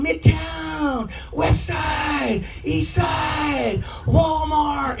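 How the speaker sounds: high, drawn-out mock gang chant; hammed-up territory holler; gangbanger side call. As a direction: call each name across the street, stretching it. Gang members calling out their sides.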